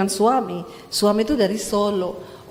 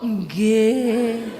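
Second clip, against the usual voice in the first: long, compliant Javanese "nggih"; mock-dutiful wifely assent; obedient Javanese wife. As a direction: answer submissively, stretching the word out. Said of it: "Istrinya, nggih".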